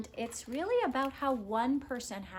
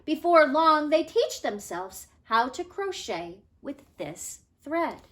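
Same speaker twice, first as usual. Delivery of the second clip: lively storybook read-aloud, louder than her own talk; reading to children; 5 semitones higher, 9 dB louder than usual. Acted. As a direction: read it out to the class with bright expression. Teacher reads picture book aloud.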